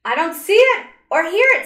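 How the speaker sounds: loud, high protest; crying out. A narrator's reading. Little girl protesting to the angel, crying out loud. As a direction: cry out that you can't hear it. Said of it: "As she cried out".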